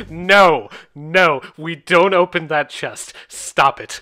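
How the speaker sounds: loud, firm warning. Acted. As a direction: stop them, loud and firm. NPC stops a player opening the chest.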